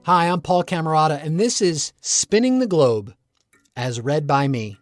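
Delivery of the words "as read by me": ordinary delivery, nothing marked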